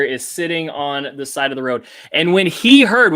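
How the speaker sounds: usual speaking voice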